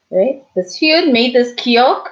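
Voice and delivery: ordinary talk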